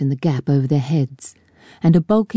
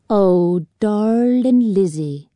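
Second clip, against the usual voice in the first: higher, slow swooning sigh; paddy mocking the boy's sweetheart in a slow swoon; mock lovesick; 5 semitones higher, much slower than usual. A narrator's reading. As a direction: ham up a lovesick sailor.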